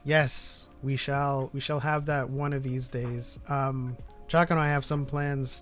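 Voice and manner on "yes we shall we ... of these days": normal talking voice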